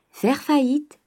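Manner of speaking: high, pointed repeat of Faire faillite; sharp echo. Acted. Father echoing his daughter's question, high and pointed. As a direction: fling the word faillite back at her. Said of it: "reprit le père".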